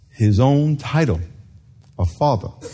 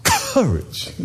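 high, quavering lion from Oz, said softer; Cowardly Lion voice; 8 semitones higher, 3 dB quieter than usual. Pretend. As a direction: whimper the word like the scared lion. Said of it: "like the lion on Wizard of Oz".

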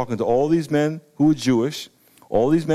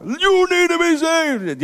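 high, stretched-out mock yell; 12 semitones higher, slower than usual. Believer yelling at someone standing right there, mock yell. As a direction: overdo a street evangelist's yell. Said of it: "yelling at somebody if they're standing right there".

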